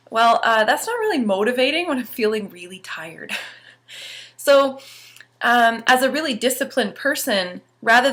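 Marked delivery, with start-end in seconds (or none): none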